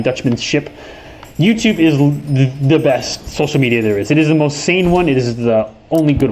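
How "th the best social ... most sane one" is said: ordinary talk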